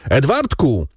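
restrained cry of alarm; sudden cry. A narrator's reading. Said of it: "krzyknął nagle".

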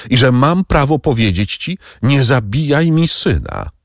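wyższy, błagalny okrzyk kobiety; zawołaj do niego z rozpaczą; wołająca Mercedes. Acted. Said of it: "zawołała Mercedes". (Mercedes woła do Edmunda, wyżej i z naciskiem)

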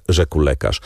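ordinary delivery, nothing marked

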